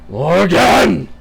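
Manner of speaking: loud, high enraged cry of her name; furious roar. Acted. Woodwose roaring at the queen, furious loud cry.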